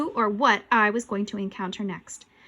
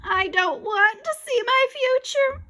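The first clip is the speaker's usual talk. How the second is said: high, loud, drawn-out scream; 14 semitones higher, 5 dB louder, slower than usual. A narrator's reading. Boy refuses the ghost, screaming. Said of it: "I screamed".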